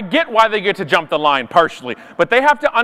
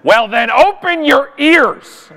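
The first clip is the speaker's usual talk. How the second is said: scolding retort; 6 semitones higher, 4 dB louder, slower than usual. Acted. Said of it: "looks me right in the eye and says".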